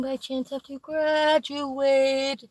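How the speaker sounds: high, drawn-out sarcastic question; mocking slow question. Sarcastically asking the friend about graduating, high and slow. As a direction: stretch it slow and high, faking innocent curiosity. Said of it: "And you're like".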